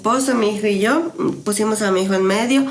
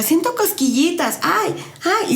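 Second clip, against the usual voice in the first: put-on child voice; 8 semitones higher than usual. Son laughing and crying, voiced.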